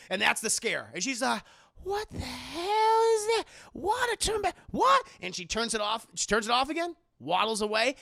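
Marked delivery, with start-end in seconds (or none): 1.8-5.1 startled character. Acted. Aunt Bam finding the water back on, high alarmed squeal. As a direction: squeal it in mock fright. Acting frightened woman at the scare.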